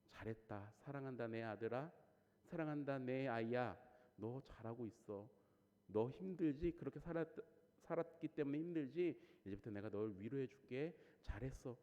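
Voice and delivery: soft, hushed consoling voice; imagined Jesus consoling the young man, softly; tender comfort. Pretend. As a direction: comfort a tired child gently. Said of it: "위로해 주는 게 아니라".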